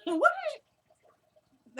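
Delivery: sudden high-pitched, startled question; real startled reaction. Real, not acted. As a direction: break off mid-question, pitch shooting up. Reacts to Jasmine's interruption.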